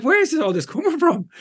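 high-pitched incredulous question; squeaky mock disbelief; mock bewilderment. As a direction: act out being baffled, pitch shooting up. Acting out own baffled reaction.

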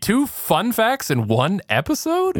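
high, drawn-out disbelief; astonished question, slow and high; incredulous. Real, not acted. Amazed at a second fun fact.

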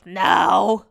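imitating a super raspy older lady; raspy mock old-lady voice. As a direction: answer in a loud, drawn-out rasp. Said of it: "in a super raspy voice".

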